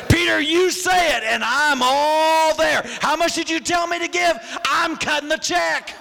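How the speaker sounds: mock eager believer.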